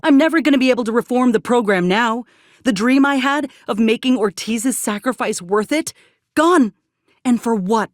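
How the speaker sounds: hurt and furious at the loss; bitter anger. Acted. Companion blaming the commander for ruining the dream, bitter and rising.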